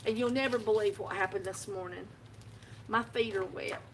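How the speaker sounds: ordinary talk, nothing marked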